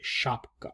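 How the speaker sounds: shapka said softly, almost breathed; Russian shapka. Shown as a demonstration. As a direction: say shapka gently with an sh start. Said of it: "S H A P K A".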